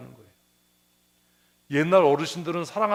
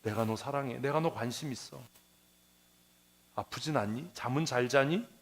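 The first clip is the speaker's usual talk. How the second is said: tender meaning; 4 semitones lower, 6 dB quieter than usual. Retold or imitated. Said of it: "뭐라고요".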